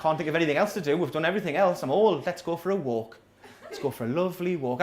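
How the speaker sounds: low, weary, giving-in reply; resigned. Retold or imitated. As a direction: sound beaten by old age. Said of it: "And you go".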